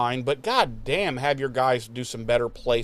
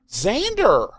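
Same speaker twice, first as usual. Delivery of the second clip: oddly loaded, higher, drawn-out name; weird Xander; 8 semitones higher, 4 dB louder than usual. Retold or imitated. Imitating Tara saying Xander weirdly to her friends. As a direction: say his name strangely, with a high swoop. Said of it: "she says it so weird".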